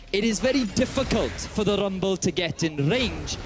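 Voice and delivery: put-on light Welsh accent calling the game for co-caster. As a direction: cast the top lane like a proud Welshman, lightly. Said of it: "could you describe what we're seeing on screen in a Welsh accent".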